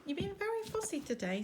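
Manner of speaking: ordinary delivery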